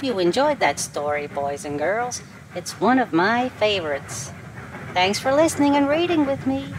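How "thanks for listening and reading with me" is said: quick, high sing-song farewell; cheery goodbye. Real, not acted. Reader to children at the end, bright goodbye.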